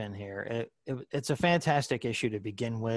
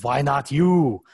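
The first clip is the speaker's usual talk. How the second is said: mock the SNL bodybuilder in an Austrian accent; SNL Arnold parody; 2 semitones higher, 8 dB louder than usual. Host quotes the SNL Arnold parody in a loud mock Austrian accent. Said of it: "parodying Arnold when he says".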